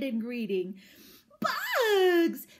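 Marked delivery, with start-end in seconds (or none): 1.4-2.5 put-on high, loud, thrilled little-girl shout; excited toddler cry. A narrator's reading. Toddler shouting with joy at the giant bugs. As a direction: squeal it like a delighted toddler. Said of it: "she cried".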